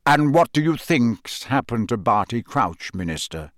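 plain reading voice

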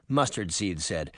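ordinary delivery, nothing marked

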